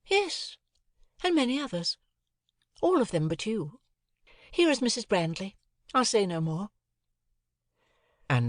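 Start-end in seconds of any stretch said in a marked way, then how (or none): 0.1-6.7 put-on lighter, higher young lady's voice; cool, airy society girl; a lightly raised ladylike voice; Estella.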